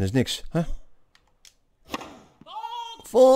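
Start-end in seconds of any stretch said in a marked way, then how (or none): 3.0-3.4 a short, high, loud yelp; sudden exclamation. Real, not acted. Gamer yelping at a shot, high and loud. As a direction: blurt it out sharply.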